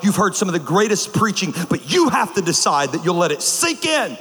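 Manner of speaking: ordinary talk, nothing marked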